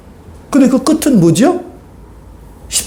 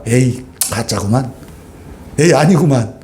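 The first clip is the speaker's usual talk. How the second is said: dismissive scoff; 10 semitones lower, 4 dB quieter than usual. Retold or imitated. Pilate dismissing Jesus as fake.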